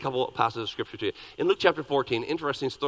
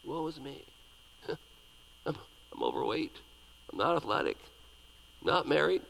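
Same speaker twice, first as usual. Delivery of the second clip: mock moping; 7 dB quieter than usual. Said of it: "mope around".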